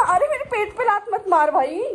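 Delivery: high, loud, laughing protest. Real, not acted. Owner laughing as the puppy kicks her belly, laughing protest. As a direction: laugh while pushing a kicking puppy off.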